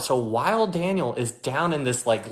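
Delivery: ordinary talk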